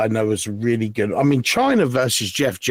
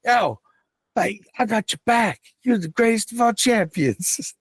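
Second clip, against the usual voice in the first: much higher, hammed-up mock British mate voice; imitating R-Truth; 10 semitones higher than usual. R-Truth greeting John Cena as a fan, in a high mock British accent. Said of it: "So good".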